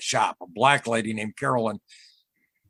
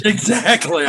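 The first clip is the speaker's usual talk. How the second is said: laughing agreement; 7 semitones higher, 7 dB louder, slower than usual. Real, not acted. Laughing at the odd mix.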